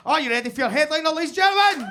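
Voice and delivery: high, fast, loud crowd-rousing call; real stage-host yell; hype shout. Real, not acted. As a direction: whip up the audience like an MC. Host hyping crowd for headliner.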